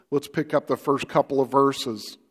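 ordinary delivery

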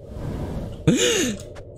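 high startled intake of breath; sharp frightened gasp. Real, not acted. Gamer jump-scared, sharp high frightened gasp.

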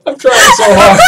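laughing through the words; high laughing cry of frustration. Real, not acted. Laughing at own failing attempts.

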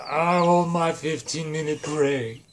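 slow, drawling put-on villain; hammed-up villain clerk; Space Voldemort. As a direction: demand it in a stretched villain whine. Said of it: "and so he's like".

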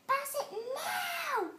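furious teammate yelling; demanding shout. A narrator's reading. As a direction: shout the order across the field. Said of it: "Carla shouted at Anna".

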